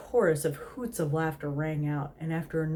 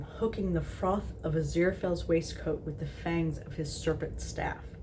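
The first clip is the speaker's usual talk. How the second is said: ordinary delivery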